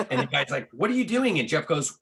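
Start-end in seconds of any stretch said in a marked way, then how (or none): 0.7-1.4 startled. Retold or imitated. Guy sees his stereo taken.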